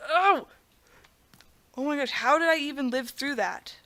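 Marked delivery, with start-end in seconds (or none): 0.1-0.5 short high cry of alarm; startled yelp. Real, not acted. Gamer nearly dying on a jump, startled yelp. Startled by near death in game.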